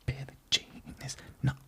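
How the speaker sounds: whispered joke under the breath. Real, not acted. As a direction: sneak the punchline in a whisper. Joke slipped in as an aside.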